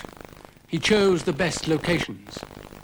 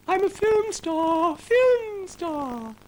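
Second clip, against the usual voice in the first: Ermentrude the cow; much slower than usual. Acted. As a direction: squeal with pride like a cow.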